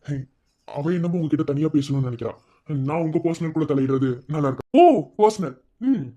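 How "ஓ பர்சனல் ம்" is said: louder, higher pointed echo; sarcastic, challenging exclamation; mocking retort. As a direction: throw the word back at him. Throws the word back in confrontation.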